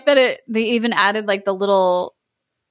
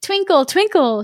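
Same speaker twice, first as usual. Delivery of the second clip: higher, eager calling of his name; cried out; 7 semitones higher than usual. A narrator's reading. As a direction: call to him with joy. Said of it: "she cried".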